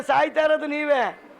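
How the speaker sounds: ordinary talk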